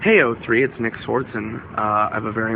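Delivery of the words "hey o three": ordinary delivery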